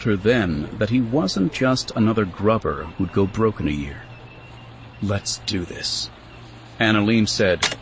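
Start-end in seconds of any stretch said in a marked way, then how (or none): 5.0-6.1 quiet, slow, set to act; soft slow line of a woman ready to work. Acted. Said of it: "snapping the power cartridge".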